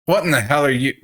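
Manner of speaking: high, loud incredulous yell; doctor shouting at the strange caregiver. A narrator's reading. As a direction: hurl the question at him. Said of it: "breaks off his shouting".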